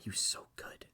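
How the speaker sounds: breathy whisper of admiration. Real, not acted. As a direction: whisper it in awe. Whispered aside about the actor.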